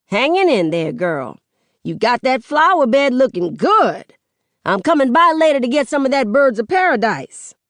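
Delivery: loud, high neighborly shout; hollering back. Acted. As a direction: chat at full volume across the street. Nana hollers back to her neighbor.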